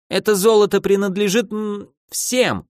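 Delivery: high-pitched protest; mildly outraged, faltering objection; indignant. A narrator's reading. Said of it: "возмутился архимаг".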